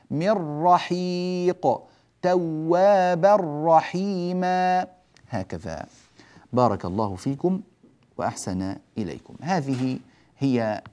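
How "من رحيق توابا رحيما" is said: من رحيق and توابا رحيما chanted slowly, nun merged into the ra without a nasal hum; slow recitation. Shown as a demonstration. Teacher reciting ra examples slowly. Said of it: "وكذلك في الراء".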